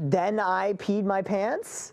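slow question with rising end; drawn-out, questioning guess; puzzled guess. Pretend. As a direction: stretch it out, unsure, lifting the end. Guesses aloud what was whispered.

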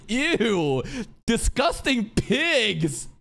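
slow, drawn-out mock disgust; disgusted noble lady. Imitating the sorceress sneering at the orcs, slow and disgusted. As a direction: sneer it like a haughty lady looking down on pigs. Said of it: "She's like".

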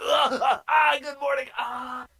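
mock waking stretch. Waking players, morning stretch.

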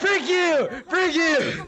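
high, loud outburst; heated taunt. Real, not acted. Cursing out another player in the game, heated yell. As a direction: yell it at a rival.

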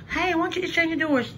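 imitating a pushy viewer, quick and high; mock viewer question. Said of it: "I know y'all gonna say".

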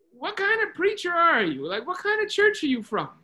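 high, loud, incredulous owner; astonished questioning. Retold or imitated. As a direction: challenge him in disbelief, pitched well up. Owner challenges the preacher, astonished.

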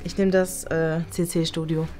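ordinary delivery

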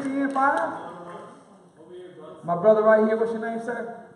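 ordinary talk, nothing marked

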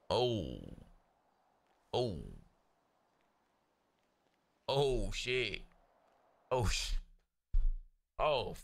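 usual speaking voice

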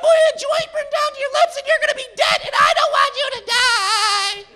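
high, frantic mock prayer, half crying; hammed-up girlfriend voice pleading loudly to God; girlfriend praying. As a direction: wail the prayer like a panicked girlfriend. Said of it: "I'm like".

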